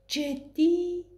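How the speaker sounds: high, incredulous "really?"; surprised. A narrator's reading. Nina leaning toward her friend, surprised and high. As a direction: leap up in disbelief.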